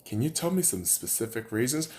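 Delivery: low, soft mock question; imitated questioner. Ranter imitates a listener asking for specific reasons, quiet mock question. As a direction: pose it quietly as someone else.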